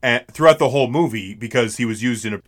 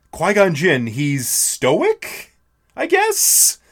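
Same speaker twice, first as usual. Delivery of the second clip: unsure fan; 5 semitones higher, much slower than usual. Retold or imitated. Fans struggling to describe character.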